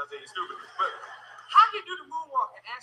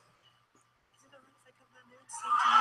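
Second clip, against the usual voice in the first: [name] impression; 2 semitones higher, 3 dB louder than usual. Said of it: "He said".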